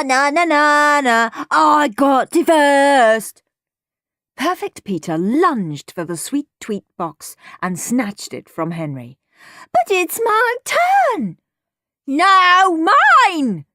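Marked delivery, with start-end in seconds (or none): 0.0-3.4 loud, high sing-song jeer; gloating taunt. A narrator's reading. Henry to his brother after grabbing the cereal box, taunting. 9.7-11.4 high, drawn-out whine of protest; protesting child. A narrator's reading. Younger brother snatching the box from Henry, loudly protesting. 12.1-13.6 high, loud possessive shriek. A narrator's reading. Henry to his brother over the cereal box, shrieking. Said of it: "shrieked Henry".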